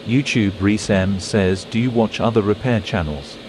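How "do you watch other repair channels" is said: ordinary talk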